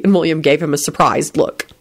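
ordinary delivery, nothing marked

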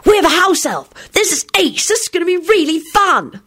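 put-on high, thrilled kid's voice; high-voiced gleeful child; excited yelling. A narrator's reading. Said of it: "Timothy yelled".

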